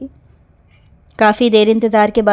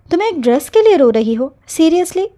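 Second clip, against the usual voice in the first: disbelief; 6 semitones higher than usual. Acted. Cousin disbelieving the crying girl.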